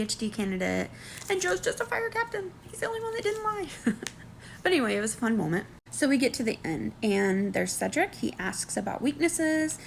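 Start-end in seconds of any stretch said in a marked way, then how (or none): none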